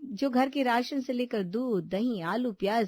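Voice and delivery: plain reading voice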